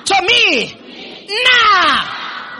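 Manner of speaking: high, loud, word-by-word cry; energetic rallying shout. Shown as a demonstration. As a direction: shout it at full force for the crowd. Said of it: "One more time".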